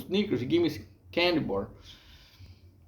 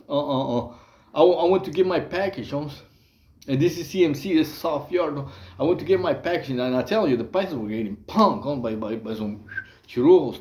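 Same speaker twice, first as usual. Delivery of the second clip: ordinary talk, nothing marked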